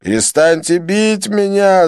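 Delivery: high, loud, slow pleading cry; pained cry. A narrator's reading. Joseph begs his beaters to stop, crying out. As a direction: beg them to stop through pain. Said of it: "закричал Джозеф".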